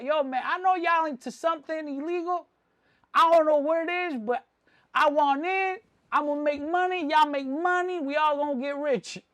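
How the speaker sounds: put-on high, excited pitch of a kid wanting in; eager kid. Kid walking into a market wanting in, eager. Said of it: "like that kid that walks into that market".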